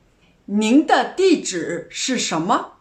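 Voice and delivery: usual speaking voice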